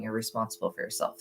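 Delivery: normal talking voice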